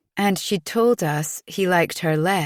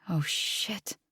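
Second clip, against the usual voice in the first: whispered curse; 10 dB quieter than usual. Acted. Dismayed reaction to bad news.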